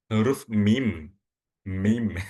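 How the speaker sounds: "mim" drawn out slowly with lips pressed shut on each m; teacher's exaggerated, lip-sealed "mim"; lips-closed mim. Shown as a demonstration. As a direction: show the letter "mim" by holding both m sounds. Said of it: "Mim itu bibir bawah ketemu dengan bibir atas".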